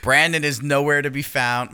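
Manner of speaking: normal talking voice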